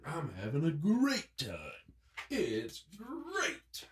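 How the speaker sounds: soft cartoon-mascot catchphrase, drawn-out GREAT; playful understated mascot impression, stretching "great"; Tony the Tiger. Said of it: "You got that Tony the Tiger".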